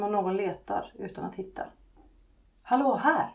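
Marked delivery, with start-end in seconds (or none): 2.6-3.3 higher, louder voiced call; louder mock whisper. A narrator's reading. Laxmi calls to the girl next door, louder stage call. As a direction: call insistently through a small hole. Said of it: "viskar Laxmi lite högre".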